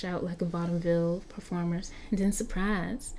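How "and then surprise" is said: ordinary delivery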